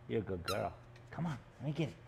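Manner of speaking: ordinary talk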